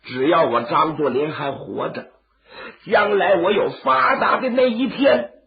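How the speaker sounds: tearful cry. Acted. Zhang kneels weeping, thanking him.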